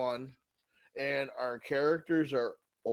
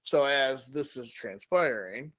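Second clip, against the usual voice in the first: ordinary talk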